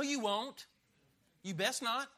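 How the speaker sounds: ordinary talk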